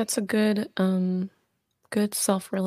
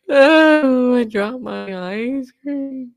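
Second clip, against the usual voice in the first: mock crying child; 6 semitones higher, 8 dB louder, much slower than usual. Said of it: "and you start crying".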